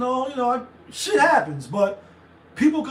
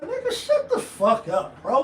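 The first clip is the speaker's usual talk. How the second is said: laughing retort; 6 semitones higher than usual. Real, not acted. Laughing rebuttal of critics.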